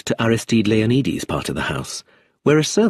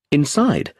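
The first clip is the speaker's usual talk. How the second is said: ordinary delivery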